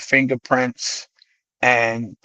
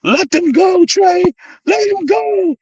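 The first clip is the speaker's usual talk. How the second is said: high-pitched, louder mock yell; retold yelling; 5 dB louder, slower than usual. Driver yells at friend fighting police, high imitated yell. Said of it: "was yelling".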